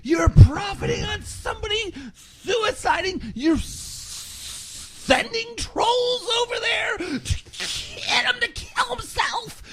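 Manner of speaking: high, shrieking mock yell of an angry troll; mocking shrill troll voice. Keemstar mocking his haters in a shrill screech. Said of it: "And they were like".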